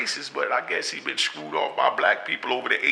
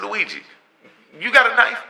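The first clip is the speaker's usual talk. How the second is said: higher and louder, alarmed; alarmed question; 11 semitones higher, 6 dB louder than usual. Retold or imitated. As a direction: ask it sharp, suddenly worried. Alarmed question to old friend.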